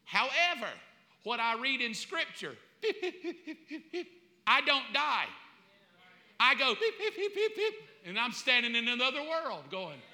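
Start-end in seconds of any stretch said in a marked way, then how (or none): 2.8-4.0 high, soft electronic beeping by mouth; imitated monitor beeps. Preacher picturing his own death, imitated monitor beeps. 6.7-7.7 high-pitched heart-monitor beeps; imitated monitor beeps. Preacher acting out leaving this world, imitated monitor beeps.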